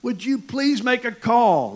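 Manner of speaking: a pastor hollering at a soccer ref for a call. Retold or imitated. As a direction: yell across the field at the referee. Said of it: "And I holler".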